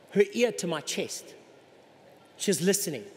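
ordinary delivery

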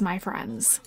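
hushed conspiratorial whisper. Real, not acted. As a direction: lean in and whisper it. Whispered aside to viewers.

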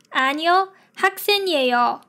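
ordinary talk, nothing marked

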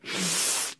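breathy little laugh; a quiet real laugh; soft laugh. Real, not acted. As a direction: chuckle under breath.

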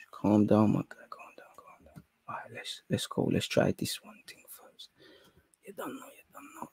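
barely audible whisper to yourself; whispered, very soft muttering; hushed self-calming. Real, not acted. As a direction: talk yourself down under your breath. Calming self while trying stream.

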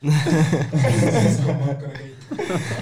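rising, long real laughter. Real, not acted. Laughing at the friend's ginger soup story, long and rising. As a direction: keep laughing helplessly.

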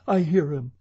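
high-pitched, urgent cry; Christine crying out. A narrator's reading. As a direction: call it out high and alarmed. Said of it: "cried Christine".

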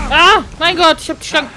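loud, high burst of excitement; excited shout. Real, not acted. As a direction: shout it out, thrilled. Hits the snake in game.

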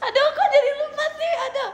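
high, fast, laughing exasperation; laughing fluster. Real, not acted. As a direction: blurt it out high and loud through laughter. Flustered, laughing at own slip. (panik sambil tertawa)

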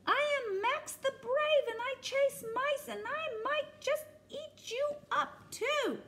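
very high proud declaration; boastful brave kitten. Acted. Max boasting to Monster, high and proud. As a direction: puff up and declare yourself.

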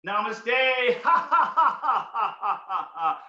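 high namaste into laughs that fade; namaste laughter. Shown as a demonstration. Greeting another friend with namaste laughter. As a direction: laugh at another friend, then let it drop. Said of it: "Other side, another friend".

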